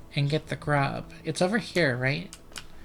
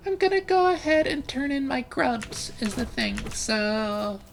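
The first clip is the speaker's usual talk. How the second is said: much higher, carefully held pitch; put-on high voice; 7 semitones higher than usual. Shown as a demonstration. Streamer practising a new voice mid-game, high-pitched. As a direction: push the voice well up and keep it there. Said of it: "try to make my voice sound like this".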